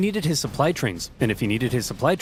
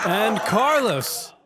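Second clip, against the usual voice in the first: high, laughing through the words; laughing reveal; 9 semitones higher, slower than usual. Acted. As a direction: giggle out the bad news. Gloating reveal to Carlos.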